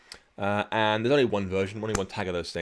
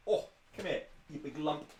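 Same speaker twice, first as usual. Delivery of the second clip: cooing to the dog; 5 semitones higher, 10 dB quieter than usual. Real, not acted. Fussing over the big dog.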